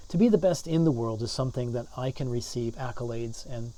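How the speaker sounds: normal talking voice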